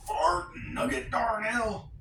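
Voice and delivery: loud, high, slow exclaimed character voice; not very good impression. Vlogger demonstrating a random voice impression, loud hammed-up character voice. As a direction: call it out high and drawn out. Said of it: "not a very good impression".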